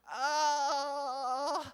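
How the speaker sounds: drawn-out imitation baby cry; long "aah" of a bawling infant; mock baby wail. Imitates his crying baby.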